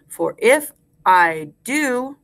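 slow, full voice; slow rhyme recitation. Shown as a demonstration. Teacher gives class a line to repeat, slow and clear. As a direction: space out each word for repeating.

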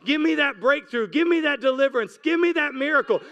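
ordinary delivery

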